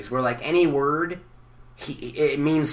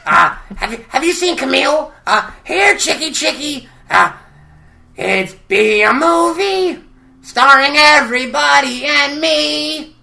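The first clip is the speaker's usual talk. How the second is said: much higher, louder, slow crackly weirdo; Gonzo impression; 12 semitones higher, 8 dB louder, slower than usual. Gonzo pitching a movie to Kermit, high and loud. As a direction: stretch it out like a dreamy oddball. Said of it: "The regular Gonzo is so much different".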